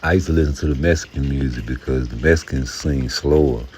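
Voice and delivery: usual speaking voice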